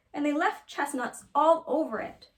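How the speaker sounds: mama bear voice. A narrator's reading. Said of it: "called Mama Honey Bear in her middle-sized voice".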